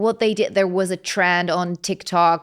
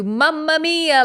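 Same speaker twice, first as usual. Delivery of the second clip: "Mamma mia" said higher and slow, the MAMMA falling; mock Italian cliché; 7 semitones higher, slower than usual. Shown as a demonstration. Mocking how foreigners imagine Italians saying "Mamma mia". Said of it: "like this".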